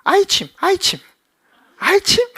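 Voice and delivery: '아이침' 하고 높은 아기 목소리로 혀 짧게 발음; '아이침'을 조르듯 올려 부르는 아기처럼. Retold or imitated. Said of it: "발음 못해가지고". (아이스크림을 '아이침'이라 발음하는 아기, 높은 목소리)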